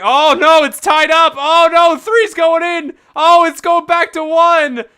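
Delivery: high, loud mock-alarm commentary; excited play-by-play. Real, not acted. Streamer calls the changing poll to chat, excited shouting. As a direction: call the race as it swings, loud and high.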